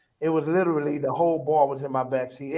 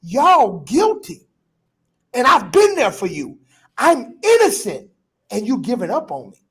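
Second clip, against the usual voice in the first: pleading outcry; 4 semitones higher, 5 dB louder than usual. Retold or imitated. Pleading with church.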